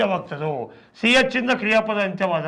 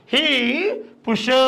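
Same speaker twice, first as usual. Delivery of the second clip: "he" held long, "pushes" stretched on its ending; drawn-out demonstration; 3 semitones higher than usual. Shown as a demonstration. Teacher showing students the ES ending on push, drawn out. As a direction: draw out "he", pause, then stretch the end of "pushes".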